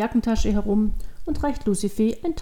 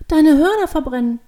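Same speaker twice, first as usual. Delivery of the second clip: put-on high, loud childlike outburst; alarmed child; 6 semitones higher, 7 dB louder than usual. A narrator's reading. Devil girl thinks the man burns horns, alarmed. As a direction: blurt it out in alarm.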